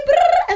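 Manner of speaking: playful high trill; high brrr sound. Acted. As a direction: trill your lips brightly. Wiggle sound effect.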